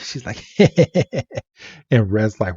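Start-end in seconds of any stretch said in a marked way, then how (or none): 0.6-1.4 short, higher put-on giggle; brief mock titter; imitated laugh. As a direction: mimic her breaking into laughter. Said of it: "she just starts to laugh".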